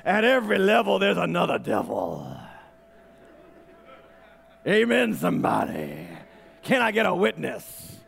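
lightly voiced old preacher, dropping low at the end; a hint of an old-days preacher's cadence; old-time gospel preacher. Quoting an old gospel preacher to the church, lightly voiced. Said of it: "I gotta say it like this".

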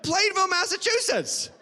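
high-pitched punchline reveal; acted amazement. Reader revealing the nearby town to congregation, acted amazement. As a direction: announce the town in mock amazement.

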